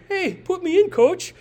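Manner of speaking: eager, high-pitched quoted plea; Teddy. Retold or imitated. Preacher quoting a player to the coach, high eager plea. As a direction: pipe up high like a player begging in. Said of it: "to quote the prophet Teddy".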